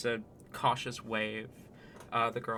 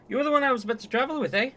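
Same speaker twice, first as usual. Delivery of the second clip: put-on high, loud, quick Canadian accent; excitable Canadian character voice; 13 semitones higher, 8 dB louder, much faster than usual. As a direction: chirp it out as a broad Canadian. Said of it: "can't wait for the accent".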